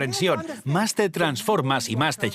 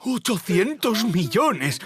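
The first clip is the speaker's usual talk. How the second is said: slow, higher, awestruck; stunned awe; 7 semitones higher, slower than usual. Retold or imitated. Seeing the bank balance on the computer, slow stunned awe. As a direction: let the huge number sink in, amazed. Seeing 800 million on screen.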